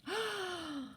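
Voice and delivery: high, breathy shocked gasp. Real, not acted. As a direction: gasp in mock horror. Reader shocked by text.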